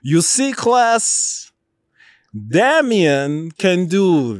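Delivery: high, loud stern teacher addressing the class. Retold or imitated. Professor addressing the whole silent class, high and loud. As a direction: command the room, then shout the last order. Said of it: "And he goes".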